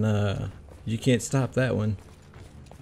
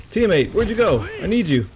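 calling out; 6 semitones higher, 4 dB louder than usual. Real, not acted. Calling teammate for help.

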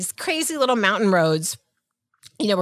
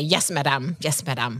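put-on Russian accent; 8 semitones lower, 4 dB quieter than usual. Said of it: "In a Russian accent".

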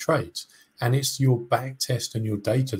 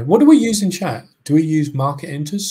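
louder and higher, throwing the question to viewers; calling out to chat; 5 semitones higher, 7 dB louder than usual. Real, not acted. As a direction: raise your voice to get the chat's attention.

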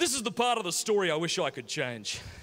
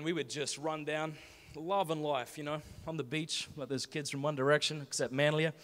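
normal talking voice

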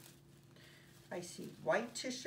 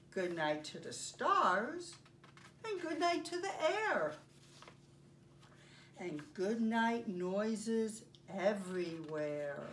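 gentle sing-song storybook reading; reading to children. Acted. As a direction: bedtime story read aloud. Teacher reads picture book aloud.

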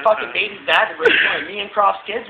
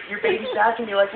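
ordinary talk

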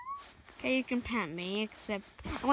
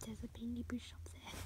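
hushed excited whisper; 13 dB quieter than usual. Real, not acted. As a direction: breathe it like a secret.

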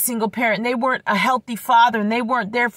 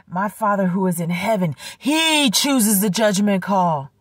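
usual speaking voice

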